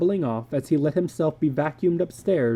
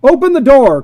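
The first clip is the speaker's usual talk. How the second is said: high, very loud shout; 11 semitones higher, 15 dB louder than usual. Acted. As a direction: bang and yell. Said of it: "cried George Hadley".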